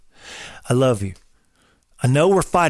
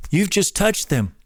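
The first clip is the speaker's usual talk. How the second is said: normal talking voice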